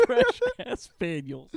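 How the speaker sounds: laughing through the words; cracking up while saying it. Real, not acted. Laughing at made-up word.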